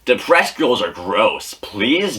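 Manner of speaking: ordinary delivery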